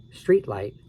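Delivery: usual speaking voice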